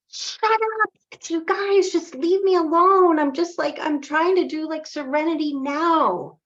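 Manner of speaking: very high, loud mock meltdown; frazzled outburst. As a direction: whine loudly at people to leave you alone. Overwhelmed guard snaps at villagers.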